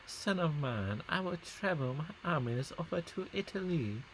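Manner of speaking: hammed-up, unconvincing Italian accent; attempted Italian accent. Gamer as a ruler telling his son of invasion, attempted Italian accent. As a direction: try again at a mock Italian father. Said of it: "that is not an Italian accent at all".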